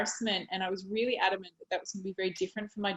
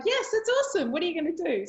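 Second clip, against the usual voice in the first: high, enthusiastic praise; cheering brother; 8 semitones higher, faster than usual. Retold or imitated. Brother congratulating his sister, high and enthusiastic. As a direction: cheer her on.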